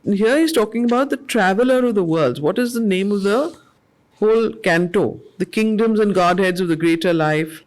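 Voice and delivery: normal talking voice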